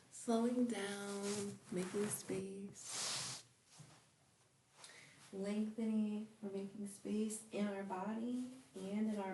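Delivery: slow, drawn-out phrases with long pauses; guided meditation. Acted. Yoga teacher guiding students to relax on their mats, guided meditation.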